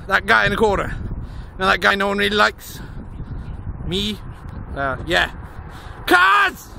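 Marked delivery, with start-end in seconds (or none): 6.1-6.7 sudden high shout; short raised outburst at the traffic; loud shout. Real, not acted. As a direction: yell it out in exasperation.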